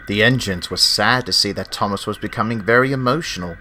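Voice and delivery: usual speaking voice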